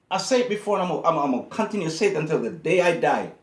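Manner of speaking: loud, emphatic scolding; heated rant. Real, not acted. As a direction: drive the point home hard. Ranting at rich athletes.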